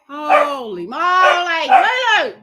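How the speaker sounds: slow, loud holy moly then a high yell of the name; exasperated exclamation. Real, not acted. To the wild puppy Lulu, a slow loud exclamation then a high yell. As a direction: draw it out, then shout Lulu.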